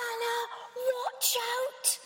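high urgent cry; mock warning scream. Mother bird warning Anna, high urgent shout. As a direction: call out to warn the chick. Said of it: "screamed out".

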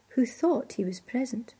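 ordinary delivery, nothing marked

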